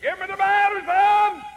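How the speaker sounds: piercing, high-pitched full-volume yell; yelling old lady. Retold or imitated. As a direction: holler it high at the top of an old lady's voice. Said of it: "yelled at the top of her voice".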